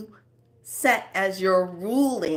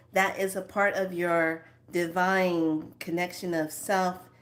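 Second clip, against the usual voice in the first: ordinary talk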